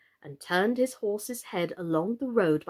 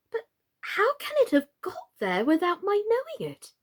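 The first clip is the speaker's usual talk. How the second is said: much higher, bewildered question; puzzled; 11 semitones higher than usual. Acted. Alice finds the crown on her head, bewildered. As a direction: wonder aloud, baffled.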